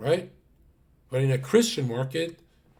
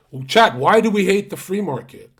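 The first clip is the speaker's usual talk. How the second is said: louder and higher, quizzing the audience; calling out to chat; 6 semitones higher, 9 dB louder than usual. Real, not acted. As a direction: throw the question out loud to chat.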